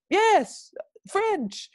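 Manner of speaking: high, loud eager yes; imitated high-pitched eager reply; excited agreement. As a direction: exclaim it as the delighted stranger. Man's eager answer acted.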